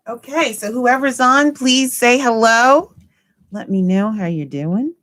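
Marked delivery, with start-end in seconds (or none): 0.1-2.9 calling out. Real, not acted. Calling out to viewers.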